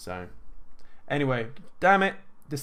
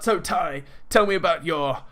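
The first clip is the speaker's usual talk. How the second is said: lightly put-on American accent, Grant Cardone quote; failed American accent; 3 semitones higher than usual. Host quoted interviewing Tai Lopez in the car, a faint American accent. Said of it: "I can't do an American accent".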